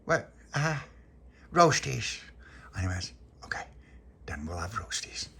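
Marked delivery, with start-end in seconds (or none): 3.4-5.3 whisper. Acted. Whispered reply in story.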